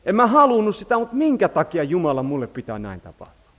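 high, loud cry of why to God; anguished protest. Retold or imitated. A believer crying to God over a lost relationship, high and loud.

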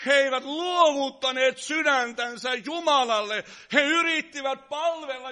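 loud fervent preaching; preaching shout. Real, not acted. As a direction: call it out to the congregation. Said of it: "Sori, kun mä huudan".

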